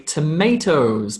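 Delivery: "tomatoes" with American "may" vowel; American "tomatoes", toe-MAY-toes; American accent. Shown as a demonstration. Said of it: "if you're an American".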